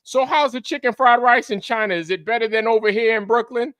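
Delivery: high, loud clueless questioning; dumb American. Acted. Clueless Brooklynite quizzing a friend living in China, high dumb voice. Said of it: "play that role right now as a dumb American".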